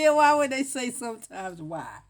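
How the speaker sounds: high, loud incredulous laughing question. Real, not acted. Questioning the vowel rule, laughing incredulously. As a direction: burst out laughing through the question.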